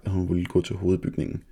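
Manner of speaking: plain reading voice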